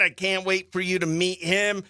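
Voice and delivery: normal talking voice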